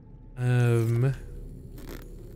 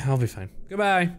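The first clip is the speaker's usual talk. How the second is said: usual speaking voice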